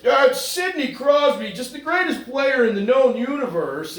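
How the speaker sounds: drool over the star like a homer announcer; gushing Pittsburgh announcer parody; mock homer announcer. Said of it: "I've heard this".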